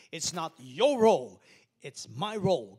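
loud, forceful declaration; loud preaching. Acted. Pastor preaching against revenge, loud. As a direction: proclaim it hard.

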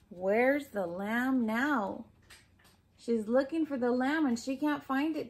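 usual speaking voice

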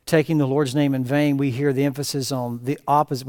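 usual speaking voice

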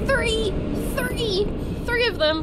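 gasp, then high panicked shouts; scared horror game streamer. Real, not acted. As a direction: yell the count in alarm. Three enemies suddenly appear.

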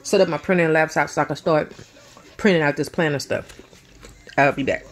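talking while chewing; words round a bite of food; mouth full. Real, not acted. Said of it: "look at me talking with my mouth full".

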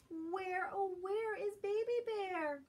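high, carrying call for a lost cub; Mama Bear calling. Acted. Mama Bear searching for her missing cub, calling high. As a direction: call out high across the woods. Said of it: "calls Mama".

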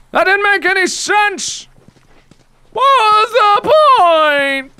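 high, drawn-out wail of disbelief; exasperated outcry. Real, not acted. Player finding no point in coming here, exasperated wail. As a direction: wail it, utterly baffled.

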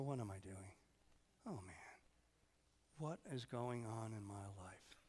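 low, soft self-questioning, slowing down; lightly acted dawning realization; prodigal coming to himself. Acts prodigal son waking up.

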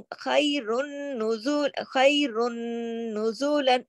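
"खैरुन नुजुलन" slowly, with a long doubled n; ghunnah recitation. Shown as a demonstration. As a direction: hum through the joined n of "खैरुन नुजुलन". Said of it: "गुन्ना भी हो रहा".

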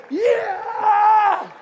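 high, laughing shout of joy; joyful whoop. Retold or imitated. Pastor celebrating the girl's healing, laughing high whoop. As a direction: whoop with delight.

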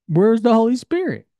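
incredulous laugh, pitch up. Real, not acted. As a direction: laugh it out in disbelief. Laughing at the missing piece.

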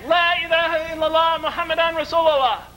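high, sustained cry of faith; retold shout. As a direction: call it out high to a whole quarrelling room. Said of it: "he shouted it again".

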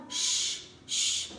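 "sh" as pure voiceless air, tongue curled back; retroflex hiss. Shown as a demonstration. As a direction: curl the tongue and push out air on "sh". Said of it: "Cong lưỡi vào bên trong và bật hơi ra". (cong lưỡi, bật hơi "sh", không rung giọng)

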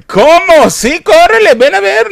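high, loud mock panic; hammed-up urgent yell; frantic shouting. Couple rushing to the sick mother, mock frantic shout.

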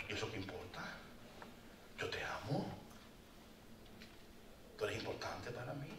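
soft, low, gentle voice of God; tender reassurance. Pretend. God to the ashamed sheep, soft and tender. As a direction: reassure her softly.

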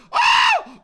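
very high, loud "oh"; real squeal of shock; excited shriek. Real, not acted. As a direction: shriek with sudden surprise. Reaction to the catch.

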